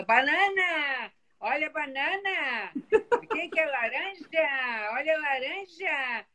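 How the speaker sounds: slow, high drawn-out market cries; street vendor calling. Retold or imitated. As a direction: hawk your fruit like a street market seller. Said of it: "Eles sabem usar a voz".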